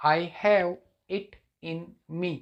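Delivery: "I have it in me" very slow, a gap after each word. Shown as a demonstration. Teacher showing students the smart answer, word by word. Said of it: "अलग-अलग बताना ही better रहेगा".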